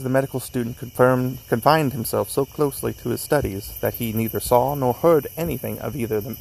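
ordinary delivery

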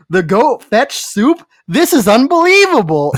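half-playful yell of a line that cracked her up; imitating a comedian's outburst without the accent; mock yell. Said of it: "But he just yells".

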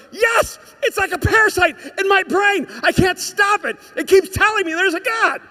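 high, full-voiced shout of confession; desperate acted outburst. Atheist admitting the voice won't stop, desperate shout. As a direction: cry out as if finally understood.

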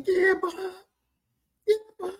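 high, fawning yes-men voices; fawning followers. Retold or imitated. Followers agreeing with dad Bob, high and fawning. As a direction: gush agreement like a sucker.

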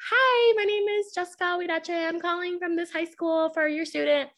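very high, sugary customer-service voice; sweet phone voice. Retold or imitated. Teacher calling a student's home, high sweet phone voice. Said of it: "You sounded so sweet".

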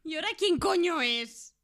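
loud, high, exasperated cry; startled angry shout. Acted. José María, shaken, shouts at the mystery voice, loud and rattled. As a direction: yell it out, spooked and annoyed.

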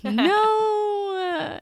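long high-pitched wailing no; hammed-up cry of protest; mock wail. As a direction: draw it out in pretend anguish. Mock protest at requested cut.

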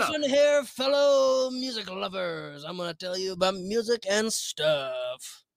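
slow, stretched-out DJ patter, starting high; fake cheesy radio voice. Shown as a demonstration. Would-be host greets music lovers, hammed-up cheesy radio voice. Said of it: "Give me a fake cheesy radio voice".

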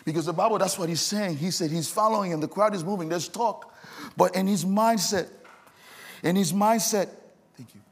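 loud, high, impassioned preaching climax; full force preaching. Acted. Preacher to the congregation, building to a loud, high passionate cry. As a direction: shout the story home to the church, building.

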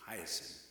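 soft, low model of "Hyacinth"; demonstrated pronunciation. Shown as a demonstration. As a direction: lead listeners in saying "Hyacinth", low and soft. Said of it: "Say it with me".

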